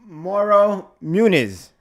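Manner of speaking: drawn-out winner announcement; winner reveal. Acted. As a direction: stretch the name like a reveal.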